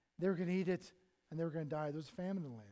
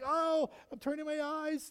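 higher, slow hammed-up fright; mock terror; 7 semitones higher, 5 dB louder, slower than usual. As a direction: play the cowering witness for laughs. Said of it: "Every time an angel of the Lord appeared".

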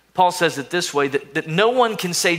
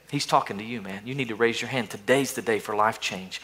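softer, lower nudge to respond; inner voice urging; 5 semitones lower, 6 dB quieter than usual. Acted. Inner voice urging the listener to respond, softly. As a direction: gently urge them to raise a hand. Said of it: "you hear the inner voice say".

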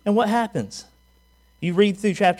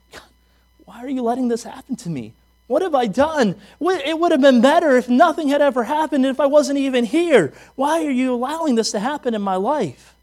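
higher-pitched mock whine; whining complaint; 7 semitones higher than usual. Believer at the Red Sea whines to God, whining complaint. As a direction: plead and complain, acting out self-pity. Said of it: "We start to whine to God".

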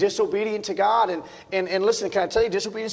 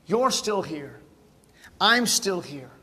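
ordinary talk, nothing marked

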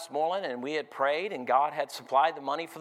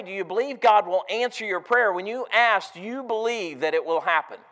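louder, rising preacher's call to the church; full-force preaching; 4 semitones higher, 7 dB louder than usual. Acted. Preacher urging his congregation, loud and driving. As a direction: press the question home to the congregation.

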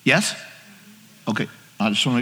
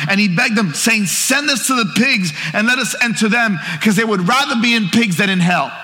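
raised pitch, driving sermon delivery; animated preacher retelling; fervent preaching; 5 semitones higher than usual. Acted. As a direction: press through the scripture with urgency. Preaches at full force.

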